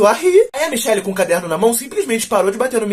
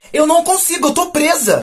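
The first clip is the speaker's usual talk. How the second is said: stuck and whining; 8 semitones higher than usual. Retold or imitated. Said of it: "Aí ela falou".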